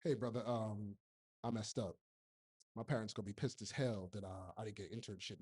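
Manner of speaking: low, quiet, embarrassed plea; sheepish younger self. Retold or imitated. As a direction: admit the mistake to the engineer with head down. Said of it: "And I'd say".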